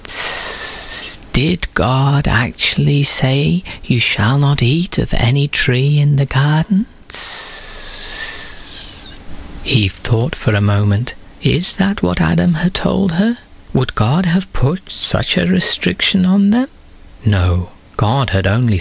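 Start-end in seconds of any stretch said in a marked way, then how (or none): 0.1-9.2 sly snake voice, slow, with hisses; soft hissing snake. A narrator's reading. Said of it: "The snake spoke softly to Eve". 11.4-16.7 put-on higher, questioning young woman's voice; Eve wondering. A narrator's reading. Eve's doubtful inner voice.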